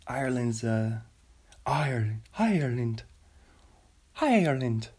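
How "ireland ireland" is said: ordinary talk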